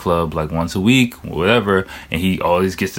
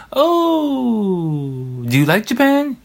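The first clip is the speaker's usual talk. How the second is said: much higher mock-surprised falsetto; surprised Japanese staff; 14 semitones higher, much slower than usual. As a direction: imitate an amazed Japanese waiter, way up high. Imitating Japanese staff questions.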